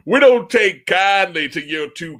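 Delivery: slow, laughing mock local threat. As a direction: drawl it like an unwelcoming local. Voicing hostile local raptors.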